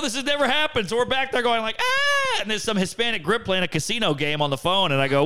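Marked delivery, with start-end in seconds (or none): 0.0-0.9 high, flustered voice; retold panic. Old stage announcer panicking, retold. 1.8-2.4 very high "ah" squeal; mock panicked scream. Retold backstage panic.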